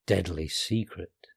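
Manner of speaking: lightly hammed-up secret keeper; deadly secret voice. A narrator's reading. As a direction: drop it down like a grave secret. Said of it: "in his best voice for deadly secrets".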